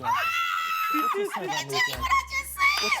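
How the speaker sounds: high shouted tease; teasing shout. Acted. Host shouting a tease at Jackie across the room. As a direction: call her out, loud and high.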